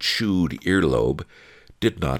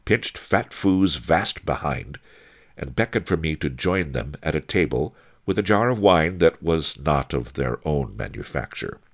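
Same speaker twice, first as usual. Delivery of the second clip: ordinary delivery, nothing marked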